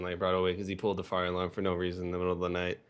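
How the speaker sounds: ordinary talk, nothing marked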